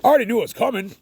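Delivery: loud, high exclamation; emphatic. Real, not acted. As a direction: belt it out knowingly. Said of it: "repeated quieter".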